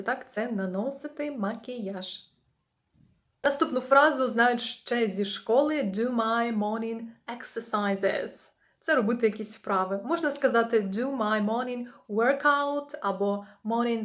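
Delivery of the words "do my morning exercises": slow model phrase. Shown as a demonstration. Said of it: "teacher models phrase".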